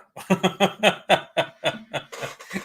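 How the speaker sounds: rolling burst of laughter; long laugh. Real, not acted. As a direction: laugh heartily at your own story. Laughing at own dancing story.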